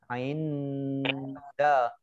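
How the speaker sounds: "ain" hummed on its n into "da"; blurred nun, ikhfa. Shown as a demonstration. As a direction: hold a veiled n before "da". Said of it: "dibaca samar-samar".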